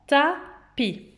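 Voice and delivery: "tapis" slowly, split into ta and pi; slow questioning "ta-pis"; syllable by syllable. Shown as a demonstration. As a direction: hold "ta", pause, then "pi". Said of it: "où entendez-vous le son A".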